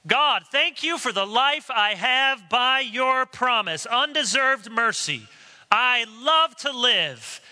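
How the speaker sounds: mock smug prayer. Pastor voicing a smug churchgoer's prayer, mock pious. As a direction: pray like a self-satisfied hypocrite.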